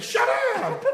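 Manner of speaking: very high, loud yell with a laugh. Retold or imitated. KGB man yelling at Anant Ashanti, laughing loud yell. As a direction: scream it at him. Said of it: "He yelled at him".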